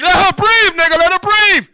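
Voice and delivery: half-joking yell to let her breathe; mock yell. Comic yelling at the porn actor to let her breathe. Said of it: "I was like".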